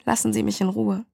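slightly brittle, understated plea. A narrator's reading. Woman asks a stranger to leave her alone, lightly brittle. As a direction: let the voice sound a little fragile. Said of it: "Ihre Stimme klang brüchig".

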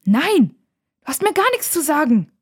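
put-on loud, high-pitched childish refusal; defiant. A narrator's reading. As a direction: defy your bossy big sister. Brother defies bossy sister.

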